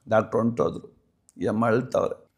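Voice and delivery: normal talking voice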